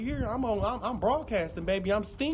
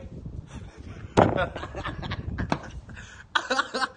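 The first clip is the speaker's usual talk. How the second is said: drawn-out, wheezy fit of laughter; long real laugh. Real, not acted. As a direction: crack up helplessly, mostly breath. Laughs at the gums joke.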